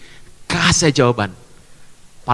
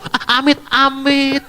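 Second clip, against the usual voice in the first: mock shopkeeper; 7 semitones higher, slower than usual. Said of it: "saya melihat banyak di toko-toko".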